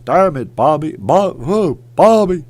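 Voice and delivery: badly faked cartoon Texan dad; loud attempt at an exasperated cartoon dad; half-successful Texan dad impression, loud; Hank Hill. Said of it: "I can't do Hank Hill".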